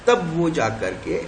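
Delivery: usual speaking voice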